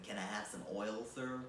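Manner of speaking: put-on low, quick, soft American accent. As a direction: redo the plea in a casual American accent. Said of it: "in an American accent".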